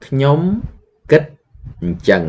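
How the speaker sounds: ខ្ញុំ … គិត … អញ្ចឹង broken into separate slow chunks; slow syllable by syllable. Shown as a demonstration. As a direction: break it up word by word for learners. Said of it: "you say".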